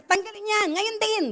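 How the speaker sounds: high, loud furious order; retold angry shout. Neighbour shouting at the bishop over the wall, angry shout. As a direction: bark the demand like the angry neighbour. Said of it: "sabi niya".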